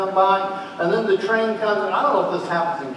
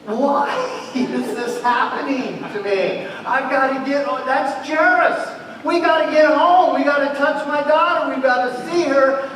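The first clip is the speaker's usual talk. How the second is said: frantic father; 7 semitones higher than usual. Acted. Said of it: "you're just".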